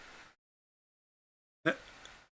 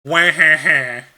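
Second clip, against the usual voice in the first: imitating Wario laugh; 4 dB louder, slower than usual. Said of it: "that one specifically said".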